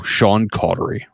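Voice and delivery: his own name said in a light Scottish accent; Sean Connery impression. Shown as a demonstration. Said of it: "I can just go".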